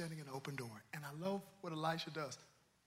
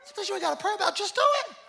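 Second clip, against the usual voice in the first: preaching shout; 9 semitones higher, 16 dB louder than usual. Acted.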